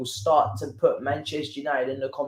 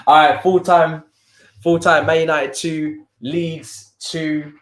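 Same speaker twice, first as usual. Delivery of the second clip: loud, raised full-time call; 4 semitones higher, 9 dB louder, slower than usual. Real, not acted. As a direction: announce it loud and high. Calling the final whistle on stream.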